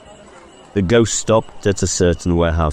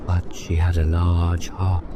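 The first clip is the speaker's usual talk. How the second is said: ghost's voice; 3 dB quieter than usual. Acted. Ghost speaks.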